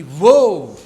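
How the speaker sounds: big swelling "whoa" for a wave; loud wave whoosh. Shown as a demonstration. Teacher showing a compression wave arriving, loud swooping whoosh. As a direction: imitate a pressure wave surging through, loudly.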